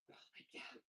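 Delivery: whispered, worn out from the exercise; breathless whisper. Real, not acted. Instructor worn out mid-workout, breathless whisper. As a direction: let it out on no breath at all.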